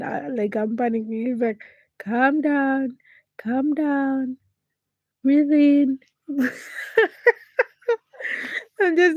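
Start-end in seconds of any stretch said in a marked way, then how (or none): 2.0-6.0 higher, louder mock of a dad calming her; dad coaching his panicking daughter on the phone, imitated higher and louder; imitating papa. 6.3-7.3 a real bright laugh. Real, not acted. Laugh after dad quote.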